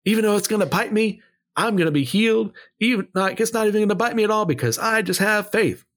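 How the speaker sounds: high mock voice of a boastful believer, rising in confidence. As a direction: ham up the naive faith claim. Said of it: "The snake did bite Paul".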